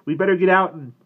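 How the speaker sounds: high, loud and urgent; panicked shouting. A narrator's reading. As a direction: shout urgent orders in alarm. Panicked shouting during a fire.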